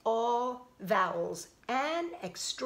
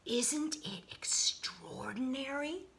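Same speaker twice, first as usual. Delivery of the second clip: slow, hushed wonder; awed whisper; 3 semitones higher, 6 dB quieter, slower than usual. Acted. Jessica showing friends a pebble, awed whisper. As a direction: breathe it out in amazement. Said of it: "she would say".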